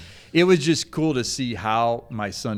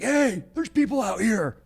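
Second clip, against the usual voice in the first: high-pitched calling out, re-enacted; retold yell; 12 semitones higher than usual. Parent in the woods alerting an unseen hunter, yelling. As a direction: holler to get someone's attention. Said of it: "I yelled something like".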